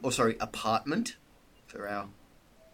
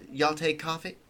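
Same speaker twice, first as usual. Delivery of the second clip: put-on Southern accent; 4 semitones higher than usual. GM playing Marianne offers the police coffee, Southern accent. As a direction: slip into a Southern hostess accent. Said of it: "I've slipped into it again".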